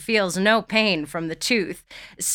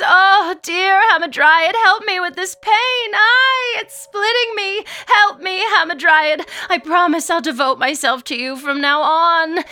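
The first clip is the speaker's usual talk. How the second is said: loud, high-pitched pleading through pain; fervent prayer; 11 semitones higher, 6 dB louder than usual. A narrator's reading. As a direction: wail a prayer as cramps hit. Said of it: "she prays".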